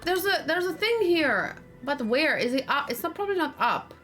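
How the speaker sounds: ordinary talk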